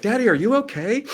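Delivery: high, loud child imitation; very high questioning little-girl voice; concerned little daughter. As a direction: pipe up like an alarmed four-year-old. Daughter reacting to dad's tears.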